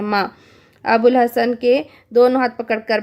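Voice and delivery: usual speaking voice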